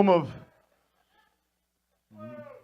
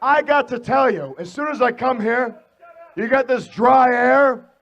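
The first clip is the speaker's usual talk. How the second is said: ordinary delivery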